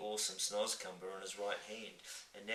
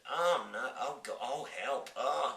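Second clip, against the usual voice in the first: put-on disgusted gagging girl; 4 semitones higher, 6 dB louder, slower than usual. Sophie disgusted.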